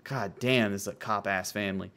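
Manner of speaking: usual speaking voice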